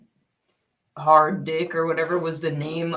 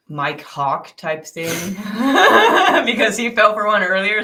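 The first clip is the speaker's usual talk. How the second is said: laughing; 4 semitones higher, 10 dB louder than usual. Real, not acted. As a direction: giggle through the joke name. Laughing at prank name.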